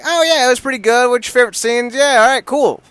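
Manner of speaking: high, loud, breezy parody of a bored reviewer; mock lazy reviewer. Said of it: "usually our reviews are like".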